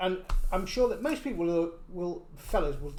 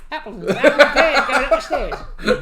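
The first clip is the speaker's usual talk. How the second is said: loud, higher Cockney with laughter; Cockney accent; 5 semitones higher, 11 dB louder than usual. Shown as a demonstration. Corrected by co-host, repeats it louder, laughing Cockney accent. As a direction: blurt out laughing Cockney rhyming slang. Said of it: "What about Cockney?".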